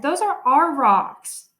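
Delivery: loud and slow, staking a claim; loud slow ownership claim; firm, loud claim. A narrator's reading. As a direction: lay it down slowly and loudly to a rival. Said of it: "I said".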